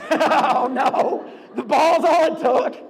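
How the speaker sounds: loud, helpless laughter through the words; laughing. Real, not acted. Host reacting to the fainting goat, laughing loudly.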